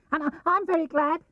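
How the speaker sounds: small, high, timid little piglet; squeaky small animal voice; Piglet's voice. A narrator's reading. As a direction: lift into a tiny piglet voice. Piglet voiced in read story.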